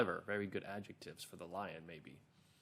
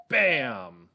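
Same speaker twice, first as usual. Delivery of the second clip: loud, drawn-out "Bam"; sound-word bang; 8 dB louder than usual. Shown as a demonstration. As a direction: burst out with the crash, falling in pitch. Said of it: "another one of these words".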